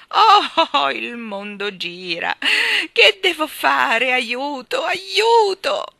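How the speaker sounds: loud, high, drawn-out wails for help; dizzy yelling. A narrator's reading. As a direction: yell as if spinning helplessly. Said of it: "urlava continuando a girare". (urla acute e allungate d'aiuto)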